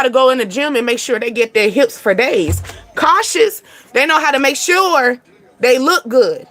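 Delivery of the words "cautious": warning. Acted. Repeated warning, pitch rising.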